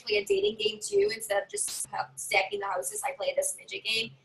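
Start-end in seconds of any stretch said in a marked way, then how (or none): none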